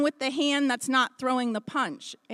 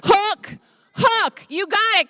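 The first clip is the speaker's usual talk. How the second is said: ordinary delivery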